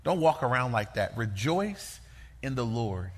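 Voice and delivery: soft, low, reassuring admonition; tender voice of the Lord. Acted. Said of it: "the Lord would say to you".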